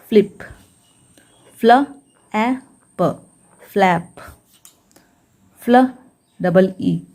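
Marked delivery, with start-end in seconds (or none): 1.6-3.1 "फ्ल, ए, प" as isolated sounds with pauses; sounded out. Shown as a demonstration. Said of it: "एफएल को पढ़ाता है फ्ल". ("फ्ल, ए, प" को रुक-रुक कर बोलें)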